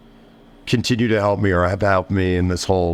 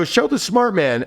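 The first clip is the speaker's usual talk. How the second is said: high, slow sarcastic showman; sarcastic fanfare for the customer; mocking; 11 semitones higher, slower than usual. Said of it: "so I go".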